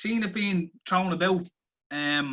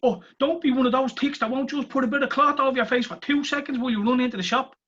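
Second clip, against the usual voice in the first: high-pitched imitation of mask excusers; mock pleading; 8 semitones higher, 3 dB louder than usual. Mimicking people who excuse masks in shops, mock pleading. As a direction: plead their case in a higher, put-on voice.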